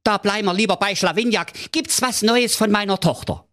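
very high, hurried king voice, impatient; high-pitched royal fusspot rushing the servant; fussy king. A narrator's reading. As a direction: squeak it out like a flustered little king. King voice in fairy tale.

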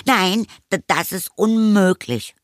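high, stammered protest; alarmed boy. A narrator's reading. Anton keeps mother from cupboard, alarmed. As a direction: blurt it high to stop her.

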